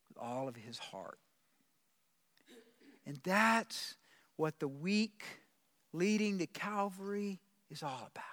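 hushed tender preaching. Real, not acted. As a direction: lean in and speak it gently to each listener. Preacher drops to intimate hush.

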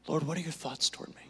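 hushed, quick asking of the Lord; soft quick prayer. Shown as a demonstration. Preacher modelling a prayer for the congregation, hushed. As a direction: breathe the prayer quietly. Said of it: "Look to him".

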